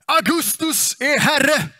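long, drawn-out shout, high and loud; acted acclaiming shout. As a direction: call it out to the whole army as a herald would. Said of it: "någon som kommer ropa".

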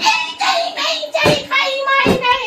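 high, drawn-out mimicry of demands; slow, high-pitched mock whining; mocking imitation. Wife mimics phone-bound husband's demands, high and mocking. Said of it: "পুঁতে পড়ে বসে phone নিয়ে".

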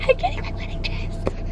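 excited secret whisper. Real, not acted. Whispering to the camera about her wedding dress, giddy whisper. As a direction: breathe it like a thrilling secret.

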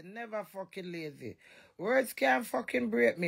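ordinary delivery

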